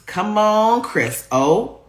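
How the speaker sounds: usual speaking voice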